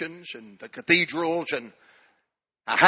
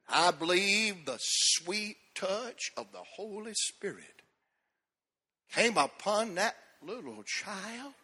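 soft, slow, drawn-out sermon voice; reverent slow preaching; 3 semitones higher, 6 dB quieter, slower than usual. Acted. Preacher telling of the Spirit on the child, slow and soft. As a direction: linger over each word in awe.

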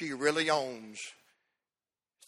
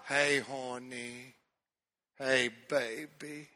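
mama's tender tone; much slower than usual. Retold or imitated. Son imitating mother answering his call, slow and tender. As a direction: draw it out sweetly like a doting mama. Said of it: "talks to me in that tone".

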